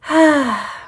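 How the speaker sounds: audible, falling sigh; long exhale after a tiring day; weary sigh. Real, not acted. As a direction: let out a big tired sigh. Tired, sighs between topics.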